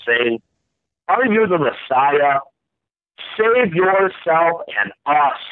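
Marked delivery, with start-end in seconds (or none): 1.1-5.4 insulting read. A narrator's reading. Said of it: "hurled insults at Jesus".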